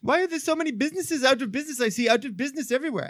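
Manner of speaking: fast, high imitated Nepali accent. Nepalese intern notices shuttered shops, quick imitated Nepali accent. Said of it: "that was not an offensive accent".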